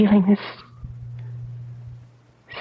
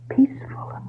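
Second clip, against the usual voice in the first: dreamy whisper; 5 dB quieter than usual. Acted. Describing upstairs dreamily.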